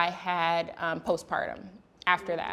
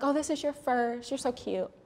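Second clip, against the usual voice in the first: high, softer mock-sweet patronising voice; condescending nurse; 6 semitones higher, 5 dB quieter than usual. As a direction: talk down to her like a cute child, higher. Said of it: "She's like".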